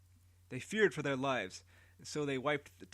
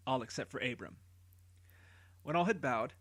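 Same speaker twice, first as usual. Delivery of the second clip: plain reading voice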